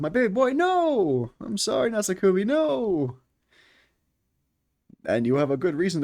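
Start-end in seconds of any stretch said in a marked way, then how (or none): 0.0-3.2 high, slow wail of mock grief; hammed-up lament for a dead favourite; wailing over a killed game character; mock anguish. Real, not acted. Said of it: "My favorite character was killed".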